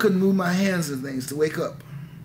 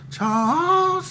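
high, drawn-out call of a name; eerie high summons of a child's name; old-time calling voice; 12 semitones higher than usual. Retold or imitated. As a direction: call the name like a ghostly mother from afar. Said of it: "that old time voice".

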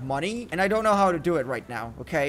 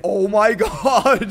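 slow, loud laughing oh my god; stunned gamer laughing at his huge yacht, slow and loud; laughing shock; 3 semitones higher, 9 dB louder, slower than usual. Real, not acted. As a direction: laugh it out in disbelief. Sees huge yacht spawn.

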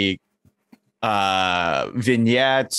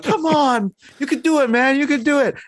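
much higher, louder mock pep talk; cheering wingmen; 3 dB louder than usual. Wingmen urging Peter to cry, in high goofy encouragement. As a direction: hype him up like goofy sidekicks. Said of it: "the wingmen are like".

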